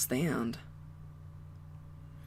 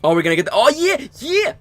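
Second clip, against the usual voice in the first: 越说越高越响的兴奋喊叫; 像终于等到好东西一样叫出来; 激动大喊; 11 semitones higher, 7 dB louder than usual. Real, not acted. Gets excited over the game.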